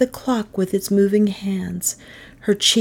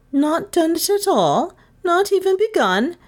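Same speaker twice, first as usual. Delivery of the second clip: high, rising disbelief; incredulous teacher; 12 semitones higher than usual. Acted. Miss Todd to pupil with no answer, incredulous. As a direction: question it high, dipping then rising.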